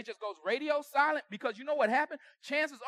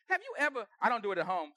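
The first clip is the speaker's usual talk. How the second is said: normal talking voice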